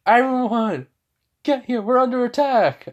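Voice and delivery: high, loud urgent shout to the squad; alarm shout. Acted. Captain shouting to everyone during an explosion, loud alarm. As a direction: yell the warning as the door blows in.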